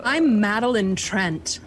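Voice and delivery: slow, lightly British introduction; put-on British accent. Player tries out her cover name, put-on British accent. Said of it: "did kinda put an accent on that".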